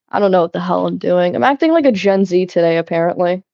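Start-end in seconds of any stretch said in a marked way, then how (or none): none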